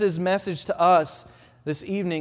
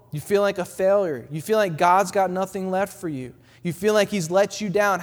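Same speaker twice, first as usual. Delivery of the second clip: ordinary talk, nothing marked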